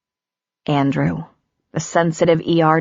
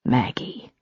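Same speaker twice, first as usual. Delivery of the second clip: soft, low and gentle; quiet, tender, lightly voiced man; calm, deep voice; 5 semitones lower, 6 dB quieter than usual. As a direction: say her name tenderly to calm her. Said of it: "in that calm, deep, lovely voice".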